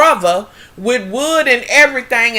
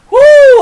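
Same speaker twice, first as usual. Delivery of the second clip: overwhelmed whoo; high loud whoo; 14 semitones higher, 11 dB louder than usual. Real, not acted. Reacting to the threesome scene, a high loud whoo. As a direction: blow it out, too much.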